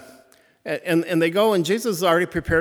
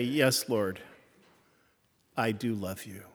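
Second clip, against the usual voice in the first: hurt but open; 4 semitones lower, 6 dB quieter than usual. Retold or imitated. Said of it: "Simon feels hurt".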